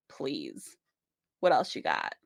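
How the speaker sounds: scoffing, drawn-out "please", low and soft; daring the cliff to try harder, mock unimpressed; cocky scoff. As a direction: brush off the mountain like it's nothing. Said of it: "you're gonna look at that and go".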